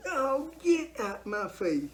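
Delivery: high, slow brush-off; get-out-of-my-face scoff; dismissive scoff. Real, not acted. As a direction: wave them off with scorn. Dismissing critics.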